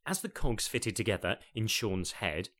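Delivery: ordinary delivery, nothing marked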